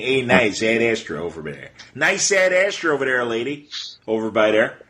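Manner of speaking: put-on Midwestern Chicago local accent; hammed-up Chicago street talk. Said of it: "that's Midwest, uh, Chicago".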